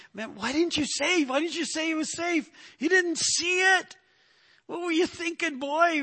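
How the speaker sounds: high, exasperated disbelief; angry coach grilling the player in the dugout; furious coach. Retold or imitated. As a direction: scold the boy like a baffled, angry coach. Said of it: "oh man, he was furious".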